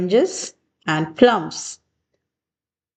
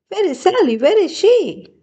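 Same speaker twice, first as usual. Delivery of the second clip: high, sing-song wondering call; 10 semitones higher, faster than usual. A narrator's reading. Seth and Tanner seeking hidden Sally, high wondering call. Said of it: "wondered Seth and Tanner".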